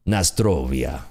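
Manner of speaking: low mock Russian toast; hammy Russian accent, pitched low; butchered Russian accent. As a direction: say it in a badly faked Russian accent. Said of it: "I'm butchering the accent".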